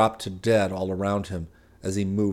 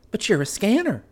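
higher wife's voice, proud and automatic; disciplined pride; 12 semitones higher, 3 dB louder than usual. Acted. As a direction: answer at once, as a proud reflex. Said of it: "Her pride was disciplined and automatic".

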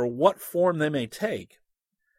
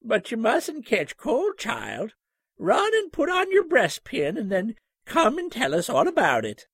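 a hint of a kindly grandmother soothing a child; gentle old lady; 8 semitones higher than usual. A narrator's reading. Old lady over her spectacles calming the scared boy, gently. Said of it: "said gently".

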